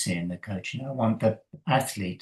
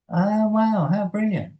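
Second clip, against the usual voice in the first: much higher, louder wow of delight; delighted praise; 11 semitones higher, 3 dB louder than usual. Retold or imitated. Coach praises the swimmer's progress, much higher and delighted. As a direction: gush with praise.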